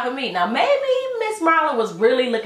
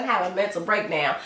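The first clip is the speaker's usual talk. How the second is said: usual speaking voice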